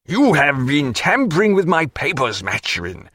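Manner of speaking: high angry burst, sinking on the name; angry accusation. A narrator's reading. Canning flushed, accusing Maturin, angry. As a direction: accuse him hotly, then drop. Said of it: "and in his passion".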